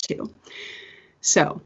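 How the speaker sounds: winded from talking, out of breath. Real, not acted. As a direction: run out of air after a long ramble. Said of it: "I'm out of breath from all that talking".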